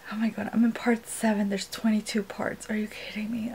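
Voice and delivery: breathy whispered disbelief. Real, not acted. Dismayed at twenty-two audiobook parts, whispered. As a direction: whisper it so nobody in the house hears. Whispering while parents home.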